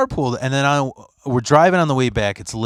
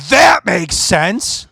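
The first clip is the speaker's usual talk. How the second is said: slow, higher sarcastic line; sarcastic drawl; 8 semitones higher, 4 dB louder, much slower than usual. Retold or imitated. Friend sarcastically agreeing about cruise control, slow and higher. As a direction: stretch it out, deadpan.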